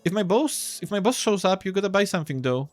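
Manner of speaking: put-on high-pitched boy vendor; hesitant kiosk boy. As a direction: bargain nervously in a high young voice.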